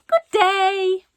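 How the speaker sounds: high, loud, sugary goodbye; cheery farewell. A narrator's reading. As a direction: send him off with false cheer. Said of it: "before adding cheerily".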